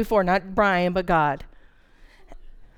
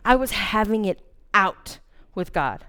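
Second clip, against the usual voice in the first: normal talking voice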